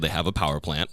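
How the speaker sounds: ordinary delivery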